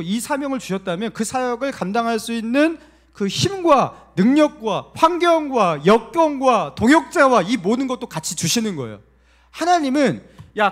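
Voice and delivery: loud, high full-force sermon; impassioned preaching. Real, not acted. Pastor urges belief that God gives strength, forceful preaching. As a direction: build to a ringing, high-pitched shout of conviction.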